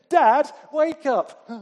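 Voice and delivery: put-on loud, high, slow child's call. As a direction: wake a sleeping dad at full shout. Child waking sleeping dad.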